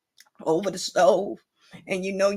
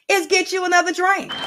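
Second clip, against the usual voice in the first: gleeful shout; 9 semitones higher, 4 dB louder than usual. Acted. Host cheerfully pushes another drink.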